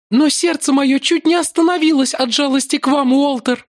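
high, breathless and frightened; breathless young woman. Acted. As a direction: gasp it out, shaken. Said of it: "выдохнула она". (высоким голосом, на выдохе)

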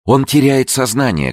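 high-pitched cry of alarm. A narrator's reading. Woman sees the man fainting, alarmed cry. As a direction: cry out as he slips away. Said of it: "крикнула Лаура".

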